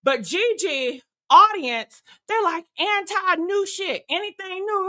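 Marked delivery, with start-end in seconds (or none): none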